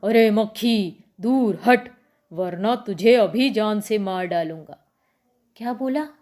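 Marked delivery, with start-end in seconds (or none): 0.0-4.9 loud, angry lion threatening the fly; lion's roar. Acted. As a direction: roar it out as an annoyed king of the jungle. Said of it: "बहुत दहाड़ा और क्या बोला".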